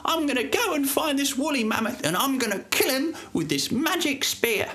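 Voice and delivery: very high, squeaky joyful Thomas; very high excited boy. A narrator's reading. Boy thanking his mother for the magic spear, very high joyful squeak. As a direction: squeal it with delight like a little boy. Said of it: "He jumped with joy and he said".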